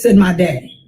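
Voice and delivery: plain reading voice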